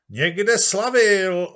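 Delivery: high, loud, slow drawn-out crowd voice; crowd answering. Acted. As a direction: call it out as the whole crowd. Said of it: "odpověděla masa". (dav pomalu a hlasitě odpovídá)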